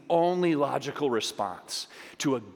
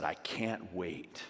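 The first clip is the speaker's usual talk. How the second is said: a hushed, longing promise; tender God voice; 5 semitones lower, 8 dB quieter than usual. Pretend. God speaking of his people, hushed and tender. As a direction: lower and softer, fading to a breath. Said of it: "He saw it and he said".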